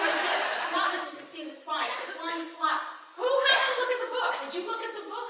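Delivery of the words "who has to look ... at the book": high, laughing question; incredulous, with a laugh; who would ever check the book; excited disbelief. Retold or imitated. Retelling inner amazed question.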